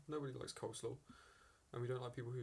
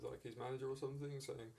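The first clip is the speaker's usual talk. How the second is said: ordinary talk, nothing marked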